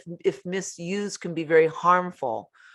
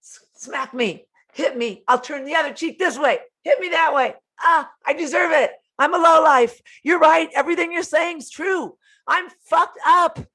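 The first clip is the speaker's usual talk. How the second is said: high, loud pleading martyr; hammed-up self-loathing, building louder; mock self-abasing victim; 12 semitones higher, 6 dB louder than usual. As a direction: wail it like someone begging for blame. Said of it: "they're doing this".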